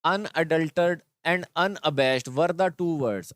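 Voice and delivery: very slow, word by word; slow classroom reading; slow reading aloud. Shown as a demonstration. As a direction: read the line out slowly for pupils. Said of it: "reads the sentence aloud".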